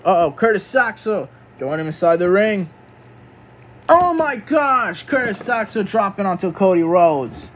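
commentary jumping high at each impact; excited play-by-play. Pretend. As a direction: call the finisher with shock. Live wrestling play-by-play.

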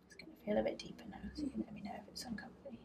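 quick hushed reassurance; fast whisper. Real, not acted. Quiet talk during palpation.

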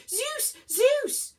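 high shrieked name, escalating; screaming. A narrator's reading. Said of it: "Virgo screamed".

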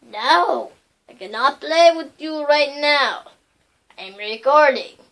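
calling out loudly to turn someone away; loud, firm brush-off; loud refusal. Real, not acted. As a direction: raise your voice to shut down an interruption. Calling off someone interrupting the recording.

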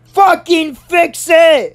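high, slow yelled command; retold yell. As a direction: imitate the principal yelling an order. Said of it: "she yells at him".

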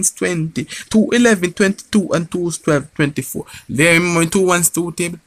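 comic high-voiced neighbour; high character voice. High put-on voice counting.

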